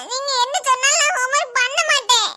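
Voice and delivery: put-on high, cheeky little girl giggling her refusal; a giggling small girl who won't do homework; cheeky child. Child defiant to mother.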